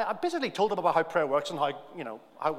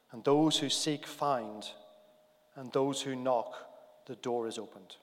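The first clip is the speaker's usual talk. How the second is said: leading prayer; 6 semitones lower, 4 dB quieter than usual. Acted. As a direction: pray it quietly and reverently for the congregation. Leads congregation in prayer.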